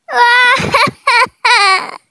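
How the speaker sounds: high drawn-out wail; wailing cry. Acted. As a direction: let out a long despairing wail. Reaction after losing.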